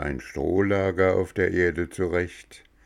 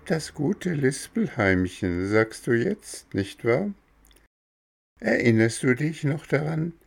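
higher, sly little cricket voice quoting him back; teasing cricket; 6 semitones higher than usual. Acted. Cricket reminds Bengele of the hammer, teasing high voice. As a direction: needle him gently about the past.

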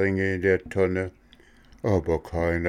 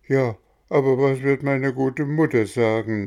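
put-on higher, louder hesitant boy; wavering boy; 6 semitones higher, 4 dB louder than usual. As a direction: torn between fun and mother. Bengele wavers.

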